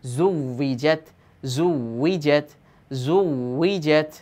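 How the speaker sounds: জুউইজত stretched out very slowly, syllable by syllable; slow repeated teacher drill of জুউইজত. Shown as a demonstration. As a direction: drag জুউইজত out slowly for learners. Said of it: "জিম তা জবর জত".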